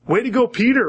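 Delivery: high, cheering congratulations; mock cheer. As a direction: cheer him on like a fan. Mock cheering Peter's insight.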